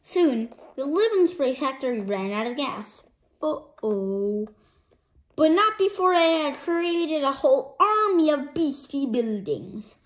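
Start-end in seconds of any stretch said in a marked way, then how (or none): none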